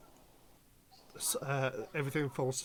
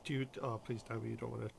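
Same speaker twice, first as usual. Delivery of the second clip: ordinary delivery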